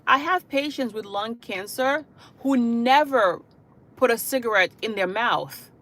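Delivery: normal talking voice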